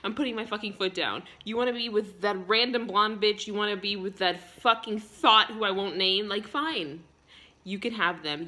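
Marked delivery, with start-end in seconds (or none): none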